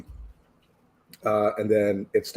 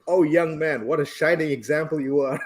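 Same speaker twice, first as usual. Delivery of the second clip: higher, gushing praise; mock gratefulness; 6 semitones higher than usual. Imagined old lady thanks the young man, gushing mock gratitude. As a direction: imitate an admiring old lady, amused. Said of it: "with, like, gratefulness".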